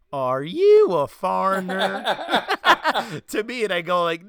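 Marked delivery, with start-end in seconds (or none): 0.1-2.0 loud, drawn-out Southern drawl, higher pitched; hammed-up Southern barista impression; North Carolina accent. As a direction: exaggerate a North Carolina twang, slow and incredulous. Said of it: "the girl at the Starbucks in North Carolina goes".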